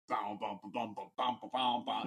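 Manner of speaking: bassy "bow bow" beat mimicked by mouth; imitated car-stereo beat. Telling how the parked car blasted music, mouthed beat. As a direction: vocalize a thumping club track.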